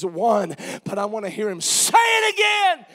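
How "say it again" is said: sudden high preacher's shout; impassioned shout. Real, not acted. Preacher longing to hear God speak again, impassioned shout. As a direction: cry it out loud from the pulpit. Preacher cries out for God's word.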